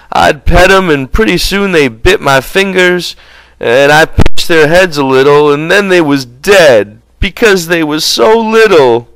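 high-pitched simple giant; childlike Lenny. A narrator's reading. Lenny explaining the dead mice to George, childlike. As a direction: talk like a big, sorry child.

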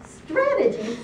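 high-pitched incredulous pig; piggy squeal of disbelief; squealed question. A narrator's reading. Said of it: "squealed one".

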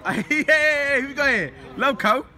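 high, loud hype welcome; big, high-pitched hello; real excited greeting. Real, not acted. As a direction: whoop as a mate turns up. Excitedly greeting an arriving friend.

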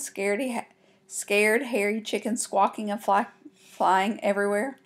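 ordinary delivery, nothing marked

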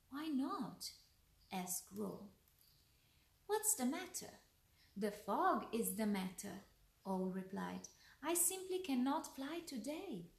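lively bedtime-tale narration; story read to children. A narrator's reading. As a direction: read a picture book aloud, pausing between lines.

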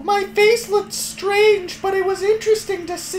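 put-on sing-song little girl diary voice. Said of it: "Read it in a little girl voice".

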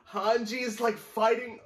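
high and loud, truly astonished; amazed outburst. Real, not acted. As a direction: shout it in disbelief at the page. Shocked Hanji is fighting.

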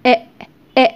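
إ، إ said as a short glottal stop for the final t; the t sound. Shown as a demonstration. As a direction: stop the breath where the t would be. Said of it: "بيتنطق". (صوت التاء كوقفة قصيرة)

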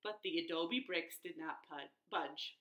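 usual speaking voice

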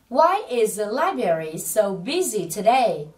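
slow, stretched teaching sentence; đọc mẫu chậm. Shown as a demonstration. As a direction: model it word by word for learners. Giáo viên đọc mẫu cho lặp lại. (đọc mẫu cả câu thật chậm)

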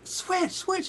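high excited call of the band name; fan calling out. Retold or imitated. Eager fan calling the band in the lobby, high. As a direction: call to them across the lobby.

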